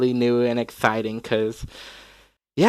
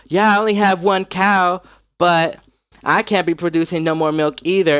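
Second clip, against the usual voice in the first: higher-pitched Southern dairy farm woman; Southern accent; 5 semitones higher than usual. Acted. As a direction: play the female farm owner with a Southern accent. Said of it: "I had to do a Southern accent".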